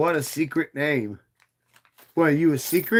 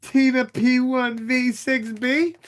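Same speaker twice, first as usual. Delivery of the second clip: high mock voice; 9 semitones higher, slower than usual. Reading username in funny voice.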